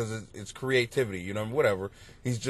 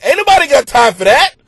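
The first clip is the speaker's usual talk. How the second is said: loud, high viral lady voice; viral lady impression; 10 semitones higher, 13 dB louder than usual. Father jokes to the doctor, loud viral lady impression. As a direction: deliver the punchline to the doctor. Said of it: "looked at the doctor and said".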